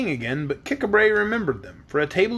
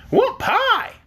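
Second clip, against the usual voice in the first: put-on very high-pitched small child's voice; squeaky-high little kid; little boy; 4 dB louder, slower than usual. A narrator's reading. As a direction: pipe up like a wee boy making a demand.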